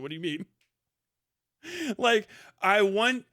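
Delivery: ordinary delivery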